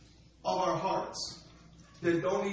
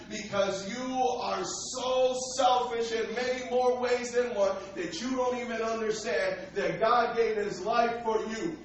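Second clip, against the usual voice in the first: normal talking voice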